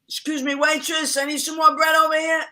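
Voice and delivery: high, loud mock customer calling the waitress; demanding Italian diner. Mimics Italians asking waitress for bread.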